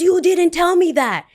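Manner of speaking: high, loud imitation of an indignant customer; mock outraged customer. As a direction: protest loudly as the mock complainer. Imitates furious customer protesting.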